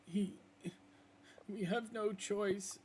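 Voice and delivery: high, slow, resigned answer; hopeless wolf. Acted. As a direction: admit it sadly.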